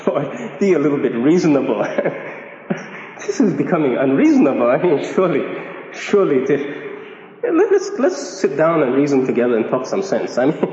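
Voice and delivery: low, chuckling protest; mock an incredulous listener, laughing between phrases; amused, half-laughing appeal to reason; imagined objector. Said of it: "you're gonna say to yourself".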